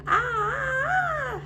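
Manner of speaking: high, loud wailing ah; sad cry. Acted. Dewey left in the back warehouse, high wailing cry. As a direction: let out a small creature's high cry.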